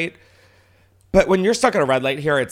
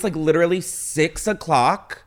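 ordinary talk

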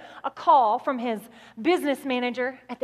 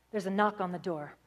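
usual speaking voice

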